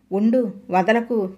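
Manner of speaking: ordinary delivery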